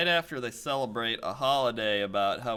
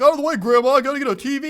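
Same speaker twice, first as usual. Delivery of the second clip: loud, high, frantic shove-past yell; shouting grandson; 14 semitones higher, 14 dB louder, much faster than usual. Retold or imitated. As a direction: yell like a greedy shopper. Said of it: "And he's like".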